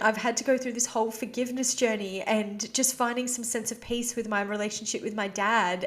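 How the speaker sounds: ordinary delivery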